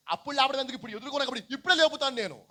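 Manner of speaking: loud, high, insistent acted reply; emphatic declaration. Preacher as Jesus promising Martha to raise him now, emphatic declaration.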